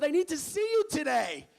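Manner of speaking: very high, booming official phone voice; loud wake-up call. Retold or imitated. Student center staffer waking a freshman intentionally, loud and commanding. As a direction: announce yourself loudly to a groggy listener.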